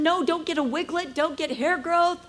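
a real cry breaking through the quote; crying while quoting. Real, not acted. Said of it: "when I told him".

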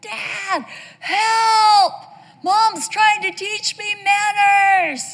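high, drawn-out wail for help, imitating a horrified son; mock child's scream. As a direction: scream for Dad like a melodramatic kid. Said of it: "he screamed out".

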